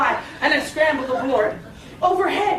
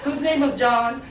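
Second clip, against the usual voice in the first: ordinary talk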